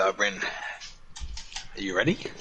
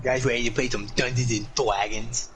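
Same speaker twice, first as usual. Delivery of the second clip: eager gamer with w for r; put-on lisping voice. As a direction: play a lisping player showing off. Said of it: "I do that voice too well".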